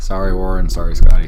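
loud mock apology. Real, not acted.

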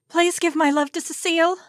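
loud, high call to someone leaving; a young woman calling after the departing lord; calling after him. A narrator's reading. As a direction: call it out to his back as he rides off. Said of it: "I called to his back".

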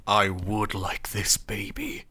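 low, slow whispered creature; creepy whisper. Pretend. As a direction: breathe it like a creepy monster. Baby Dragon quoted, whispered.

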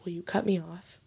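quiet, pleading aside; hushed plea. Retold or imitated. Retold discreet plea to bartender.

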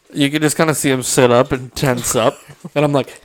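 ordinary talk, nothing marked

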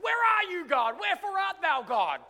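high, loud, hammed-up cry to heaven; mock angry shout at God. Preacher mocking an angry believer, hammed-up shout. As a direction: yell it up at the sky like a wronged believer. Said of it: "be like".

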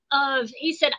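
ordinary delivery

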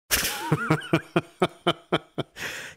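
long staccato burst of laughter; helpless chuckling fit; real laugh. Real, not acted. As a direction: crack up in a string of quick ha-has. Laughs at viewer's message.

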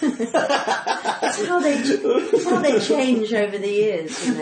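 slowed, giggling delivery of an awkward line; laughing through it. Real, not acted. Talking about her book's chapter, laughing. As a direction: laugh the words out, embarrassed.

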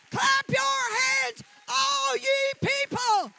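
drawn-out call to clap; full shout. Shown as a demonstration. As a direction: belt it out to the whole room. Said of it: "Everybody in this room".

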